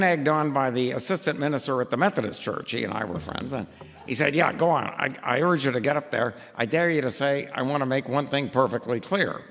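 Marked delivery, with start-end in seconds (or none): none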